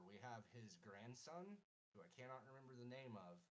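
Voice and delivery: normal talking voice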